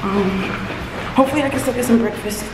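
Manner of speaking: breathless while running down stairs; panting, talking on the run; out of breath. Real, not acted. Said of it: "I'm currently running down the stairs".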